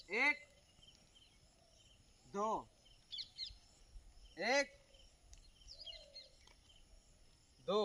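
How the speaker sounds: crisp rhythmic drill count, one word per beat. Shown as a demonstration. Instructor calling the step count to trainees. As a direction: call the beat for the squad.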